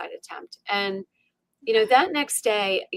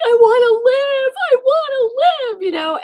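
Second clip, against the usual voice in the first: loud, high wail; retold tearful shout; sobbing; 8 dB louder than usual. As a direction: cry out desperately for life. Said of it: "I'm sobbing".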